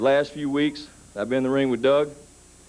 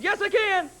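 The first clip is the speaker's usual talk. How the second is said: put-on kid's insistence; slower than usual. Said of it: "He said".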